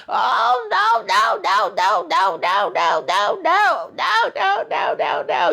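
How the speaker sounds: high, wailing cries of no; a neighbor breaking down in grief. Retold or imitated. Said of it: "She was acting very concerned and crying as well".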